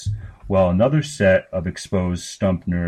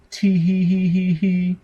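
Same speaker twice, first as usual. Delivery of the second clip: put-on high girlish giggles; 11 semitones higher than usual. A narrator's reading. Sister giggling at her brother, high childish giggles. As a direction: giggle like a delighted little girl. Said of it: "burst into a fit of giggles".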